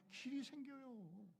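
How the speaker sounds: slow, stretched declaration; preacher proclaiming the new path, slow and drawn out; drawn-out proclamation. Acted. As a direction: draw it out slowly in triumph.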